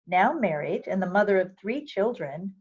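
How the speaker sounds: ordinary delivery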